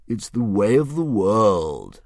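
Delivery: slow, a hint of a grand beast; drawn out like a pleased old dragon; lightly voiced dragon. Dragon musing over Hiccup's riddle, slow and lightly grand.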